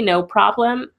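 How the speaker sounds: ordinary delivery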